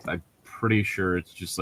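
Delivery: ordinary talk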